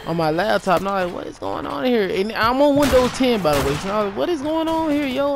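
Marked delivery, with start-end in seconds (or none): 1.1-2.1 high-pitched, bewildered reenactment; baffled disbelief. Retold or imitated. Vlogger retelling sudden laptop pop-ups, baffled and high-pitched. 4.2-5.3 high-pitched, bewildered reenactment; baffled disbelief. Retold or imitated. Vlogger retelling sudden laptop pop-ups, baffled and high-pitched. Repeats baffled reaction to popups.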